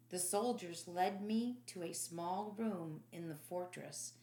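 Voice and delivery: ordinary delivery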